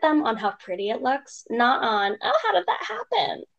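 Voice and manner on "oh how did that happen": high mock-nosy question; nosy stranger. Redhead's mom mocking strangers who pry, high mock voice. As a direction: pitch it way up like a busybody.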